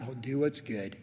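ordinary talk, nothing marked

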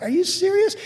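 incredulous. Retold or imitated. Pastor reacting to the family calling police, incredulous. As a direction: shoot up in disbelief.

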